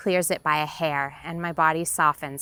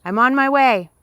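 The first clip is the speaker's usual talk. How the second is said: higher, loud promise called out; reassuring shout; 6 semitones higher, 8 dB louder than usual. Acted. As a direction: call out to reassure her. Dad calling he's coming.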